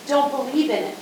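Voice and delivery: ordinary delivery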